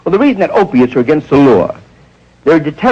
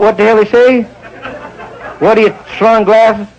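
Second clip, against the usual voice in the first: confused drawl. Retold or imitated. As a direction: repeat it baffled. Confused Southerners repeating.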